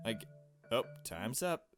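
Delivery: ordinary talk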